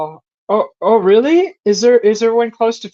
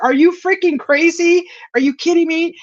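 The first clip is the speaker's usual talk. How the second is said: high, disbelieving outburst at the husband; are you out of your mind, pitched way up; telling the husband he's crazy, high and incredulous; 10 semitones higher than usual. Retold or imitated. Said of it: "and I'm like".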